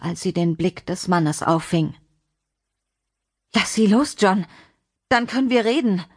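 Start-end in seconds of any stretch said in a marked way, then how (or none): 3.5-6.0 higher, urgent negotiating voice; tense plea. Acted. Lina bargaining with John for her daughter, tense. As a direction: plead urgently but keep control. Mother pleading for her child.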